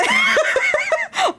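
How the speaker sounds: squealing real laugh; shrill laughter at a friend; high laughing burst. Real, not acted. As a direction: dissolve into giggles. Laughing at Smooth's pain.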